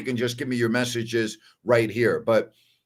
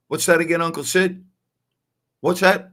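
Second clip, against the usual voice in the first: retold puzzled asking; 5 semitones higher, 3 dB louder than usual. Retold failing to understand uncle.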